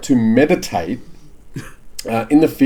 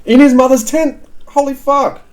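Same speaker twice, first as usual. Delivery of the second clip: high, loud disbelief; shocked; 13 semitones higher, 5 dB louder than usual. Real, not acted. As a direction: gasp it out in shock. Shocked reaction.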